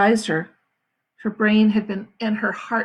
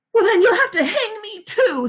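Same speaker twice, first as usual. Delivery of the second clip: defiant woman; 12 semitones higher, 4 dB louder than usual. A narrator's reading. Catherine defiant.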